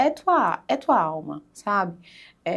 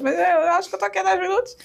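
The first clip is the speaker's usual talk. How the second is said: higher, louder and quicker, frantic inner thought; anxious rush; 8 semitones higher, 3 dB louder than usual. Retold or imitated. Retelling her first minutes on the retreat, higher, louder, frantic. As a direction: race through it like panic is rising.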